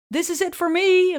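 shouting a farewell down the street; calling out. Retold or imitated. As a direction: call it out to the backpackers leaving. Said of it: "roep ik".

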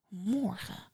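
soft tipsy lisp. Acted. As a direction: lisp the words lazily. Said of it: "lispelde ze".

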